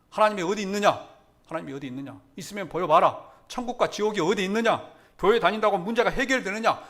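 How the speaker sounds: loud, high mocking challenge; jeering. Preacher voices the world scoffing at Christianity, loud jeering. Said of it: "이렇게 조롱하고 비웃습니다".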